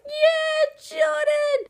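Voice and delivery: very high, loud crowd cheer; reenacted squealing cheer for the performer; fan cheering. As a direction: shriek it like a fan at a gig. Reenacts cheering as he takes stage.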